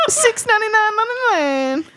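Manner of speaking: high, hammed-up price call; mock ad pitch. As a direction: sing out the price like a spoof ad.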